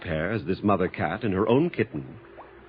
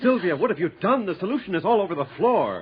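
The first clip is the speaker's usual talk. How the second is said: alarmed rebuke; 8 semitones higher than usual. Acted. Assistant spills the dangerous solution.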